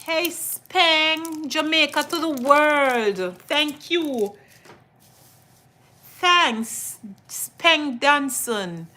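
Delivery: greeting a Jamaican viewer with excited cheer; excited shout-out. Real, not acted. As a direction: hype up the viewer by name.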